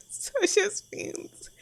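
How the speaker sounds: high, slow, breathy laugh-line; laughing through it. Acted. Server admitting there's only beans, laughing. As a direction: giggle out the punchline.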